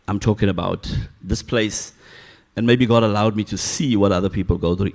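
ordinary talk, nothing marked

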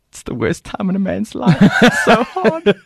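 loud high laughing through the words; laughing shout. Real, not acted. Talking to Brent, he bursts into loud laughter. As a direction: crack up while shouting it out.

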